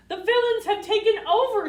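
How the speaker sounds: high, loud alarmed cry. A narrator's reading. Pinocchio warns Shrek the villains won, high loud alarm. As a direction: sound the alarm. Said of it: "he says".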